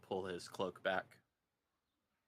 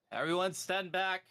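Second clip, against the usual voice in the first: high, loud shouted order; calling out; 11 semitones higher, 9 dB louder than usual. Acted. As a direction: call out a command to the whole crowd. Guard calls out at the gate.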